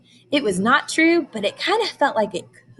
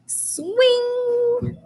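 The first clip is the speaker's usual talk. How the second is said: high, drawn-out "Swing"; excited. Shown as a demonstration. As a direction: sing out "Swing" with delight. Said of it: "read it like you're really excited".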